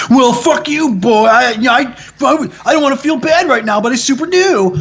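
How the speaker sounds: high-pitched hammed-up indignant protest; mock defensive response. Admitting his first reaction to being corrected, mock defensive whine. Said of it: "I have the same response".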